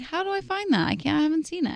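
mock-confused high voice; high confused self-quote. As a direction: squeak it out, lost. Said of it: "And I'm like".

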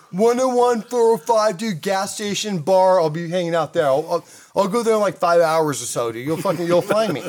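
hammed-up carefree surfer voice; mocking Danny. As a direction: rattle off the directions as a joke. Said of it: "this is what Danny said".

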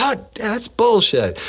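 high mock scoff at the excuse; scoffing boss. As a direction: toss it off like a boss who isn't buying it. Boss calling out his excuse.

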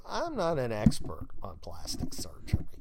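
breathy deadpan whisper; whispered aside. Retold or imitated. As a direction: mutter it under your breath as a snide aside. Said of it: "I'm going".

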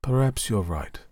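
mildly sullen giving-in; understated sulk. A narrator's reading. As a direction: give in grudgingly. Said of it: "I said sullenly".

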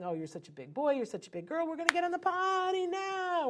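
put-on sweet praise for a toddler, rising high at the end; gentle encouraging parent; speaking nicely. Shown as a demonstration. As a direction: coax the child kindly. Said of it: "speaking nicely to the child".

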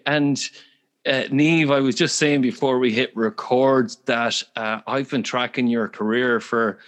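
ordinary talk

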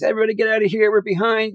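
high-pitched mock panic, rallying the crew; hammed-up harried set boss; frantic assistant director. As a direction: imitate a first AD going bananas, voice way up high. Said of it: "Selig was going bananas".

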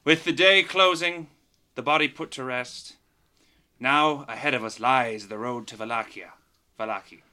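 dramatic closing, whispered title; previously on recap. Acted. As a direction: build up then whisper the name. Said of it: "Give it to us, [name]".

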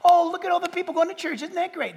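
sarcastic gushing over good news; a hint of sneering cheer; mock glee. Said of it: "They weren't going".

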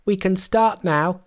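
high-pitched, amused monkey voice; high Monkey voice, a touch of mirth; gleeful Monkey. A narrator's reading. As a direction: announce it high and triumphant.

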